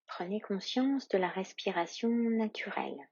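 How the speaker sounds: normal talking voice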